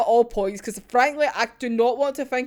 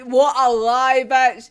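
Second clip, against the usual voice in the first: ordinary delivery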